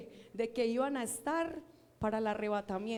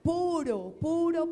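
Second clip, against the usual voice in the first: "puro" twice, louder and higher each time; emphatic repetition; 4 semitones higher, 4 dB louder, slower than usual. Shown as a demonstration. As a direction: build "puro, puro" up with stress. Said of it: "Es decir". ("puro, puro" cada vez más fuerte y agudo)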